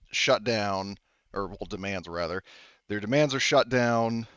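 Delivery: usual speaking voice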